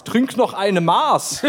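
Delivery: slow, drawn-out mock Bavarian; imitating a Bavarian impression slowly. Retelling the co-host's imitation with a slow mock Bavarian accent. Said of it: "genau so war's".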